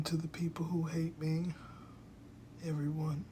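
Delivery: soft unvoiced joke aside; whispered aside. Real, not acted. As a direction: whisper it under your breath.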